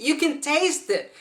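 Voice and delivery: ordinary talk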